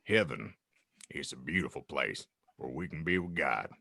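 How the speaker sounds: gentle Hagrid. Acted. Hagrid telling Harry about heaven, low soft voice. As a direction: speak low and tender to a child.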